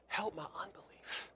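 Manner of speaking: voiceless, broken whisper of a tearful father; whispered plea. Retold or imitated. Grieving father begging Jesus, in a broken whisper. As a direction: whisper it like a man at the end of himself. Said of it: "He cries out with tears".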